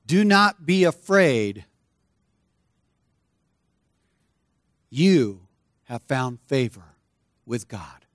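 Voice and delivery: slow, fading tenderly at the end; slow reassurance. Shown as a demonstration. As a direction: speak it gently to each listener. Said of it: "I want to speak those words again".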